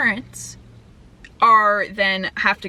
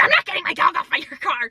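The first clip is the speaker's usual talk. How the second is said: high, put-on voice of a stubborn woman; mock her refusal in a higher, strained voice; mock indignant mimicry; 6 semitones higher than usual. Mimicking the dog owner arguing back, mock indignant.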